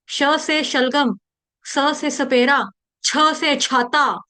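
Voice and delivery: "sh" in शलगम, "s" in सपेरा, "chh" in छाता; careful drill of शलगम, सपेरा, छाता; distinct consonants. Shown as a demonstration. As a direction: slowly separate श, स and छ. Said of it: "श, श अलग-अलग हैं".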